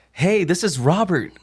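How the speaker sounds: high-pitched, eager phone greeting; so excited. Retold or imitated. New agent on his first dial day, over-excited. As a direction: imitate a keen rookie on a cold call. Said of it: "I was, like, so excited".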